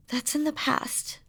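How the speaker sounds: soft reassuring whisper. Acted. Demon lover soothing his beloved in a whisper. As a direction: breathe it gently to comfort.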